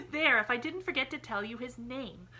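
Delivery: put-on a happy child explaining innocently; cheerful little girl. A narrator's reading.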